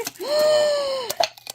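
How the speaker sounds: sharp high gasp of joy; delighted high gasp. Real, not acted. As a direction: gasp at the surprise gift. Sees the butter dish gift.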